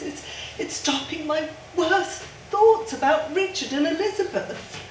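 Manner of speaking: usual speaking voice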